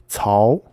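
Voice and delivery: "Cao" said slowly with a rising second tone. Shown as a demonstration. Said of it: "rising tone".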